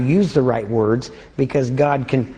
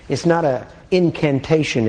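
ordinary delivery

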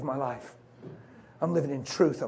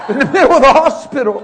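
loud, higher, through laughter; amused, raised voice; laughing; 10 semitones higher, 17 dB louder than usual. Real, not acted. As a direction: blurt it out laughing. Joking aside through laughter.

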